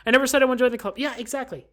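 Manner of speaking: ordinary delivery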